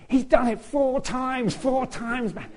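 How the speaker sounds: high, slow, drawn-out amazement; amazed exclamation. Retold or imitated. Musicians reacting at the end of the piece, high amazed exclamation. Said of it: "they're going".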